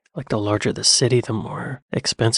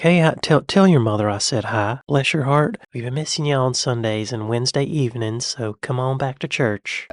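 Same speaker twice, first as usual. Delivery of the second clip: put-on Southern US accent. Churchgoer to a neighbour missed on Sundays, Southern accent. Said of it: "And the South's more like".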